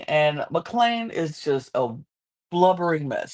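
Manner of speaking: ordinary talk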